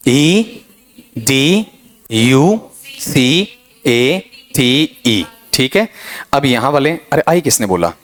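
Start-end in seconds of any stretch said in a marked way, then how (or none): 0.1-5.3 letters spelled out one by one, very slowly; slow spelling. Shown as a demonstration. Teacher dictates the spelling, letters spaced slowly. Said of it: "spelling बोल दो पहले".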